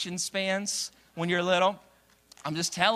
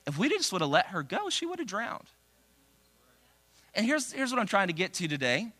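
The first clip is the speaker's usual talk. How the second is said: usual speaking voice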